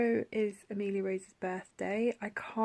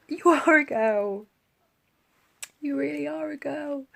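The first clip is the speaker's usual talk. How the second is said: joyful disbelief; 7 semitones higher, 8 dB louder than usual. Retold or imitated. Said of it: "And then I said".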